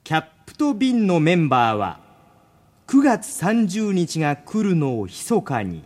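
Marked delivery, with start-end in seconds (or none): none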